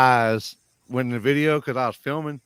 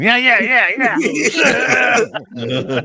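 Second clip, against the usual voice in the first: higher, louder, laughing mock yeahs; mock coaxing; 11 semitones higher, 8 dB louder, slower than usual. Flagger jokes about himself asleep, laughing mock voice. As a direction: jokingly mimic own sleep-flagging. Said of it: "pulling my dick out and going".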